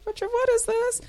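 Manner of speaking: puzzled. Retold or imitated. Puzzled question to her husband.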